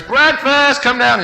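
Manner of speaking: high, loud call up the stairs; hollering upstairs. Retold or imitated. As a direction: call the boys down to breakfast. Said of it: "Mom would holler upstairs".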